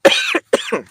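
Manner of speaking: sudden sharp cough; coughing fit. Real, not acted. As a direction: choke and cough. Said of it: "Excuse me".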